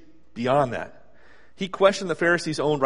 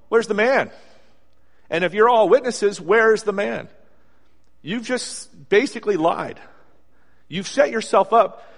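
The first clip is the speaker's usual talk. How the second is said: indignant challenge; 5 semitones higher, 5 dB louder than usual. Acted. Preacher challenging the accusers.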